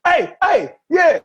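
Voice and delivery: high-pitched mock hollering; imitated calling out. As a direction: imitate a wrestler hollering as he walks in. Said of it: "He was like".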